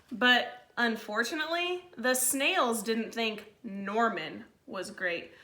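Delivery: put-on lively read-aloud to kids; storybook reading. A narrator's reading. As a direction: read it like a picture book to the class. Held story narration for children.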